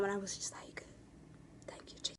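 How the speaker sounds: hushed unvoiced aside; whisper. Real, not acted. Fan whispering her thanks for the photo. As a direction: say it under your breath.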